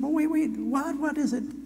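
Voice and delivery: high, puzzled questioning voice; elder asking the girl what's going on; elder interrupted. Acted. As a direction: stop mid-prayer, confused. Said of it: "She said".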